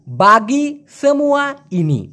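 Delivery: বাগি সেমুয়া ইনি spoken slowly, syllable by syllable; slow Malay demo. Shown as a demonstration. Teacher modelling the Malay for Bengali students, slow. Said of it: "ওরা বলে".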